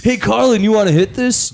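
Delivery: high, stoned bro voice; stoner Jay. Retold or imitated. Podcaster voicing stoner Jay offering Carlin a hit, high bro voice.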